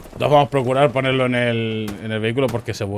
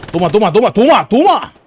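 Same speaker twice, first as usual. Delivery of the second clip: excited cheering; 10 semitones higher, 9 dB louder than usual. Real, not acted. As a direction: chant it louder and higher each time. Cheering a kill streak. (celebración a gritos, cada vez más aguda)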